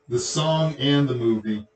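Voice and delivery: normal talking voice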